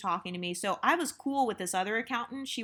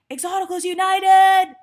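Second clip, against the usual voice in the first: much higher and much louder, calling slowly down the hallway; shouting a name; 11 semitones higher, 15 dB louder than usual. Retold or imitated. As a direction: yell the name across the hall. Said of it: "she would literally shout my fucking name".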